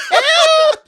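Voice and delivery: very high, loud comic shriek; mock ghostly cry for help. Comic playing dead Matilda, high mock shriek. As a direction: shriek it like a hammed-up dying ghost. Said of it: "you can still hear her saying".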